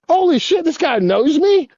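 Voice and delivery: high-pitched retold astonishment; star-struck disbelief, pitched right up; amazed. Skater recalling being recognized by his idol, amazed.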